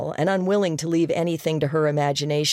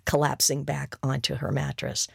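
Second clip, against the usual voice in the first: plain reading voice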